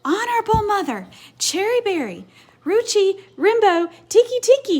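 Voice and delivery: high, loud gasped-out name; gasping, jumbled-up name. Acted. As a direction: pant the words out in a jumble. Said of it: "he gasped".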